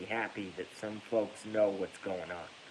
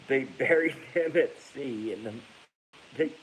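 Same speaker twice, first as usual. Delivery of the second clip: laughing through words; 3 semitones higher, 5 dB louder than usual. Real, not acted. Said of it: "How do I even control myself?".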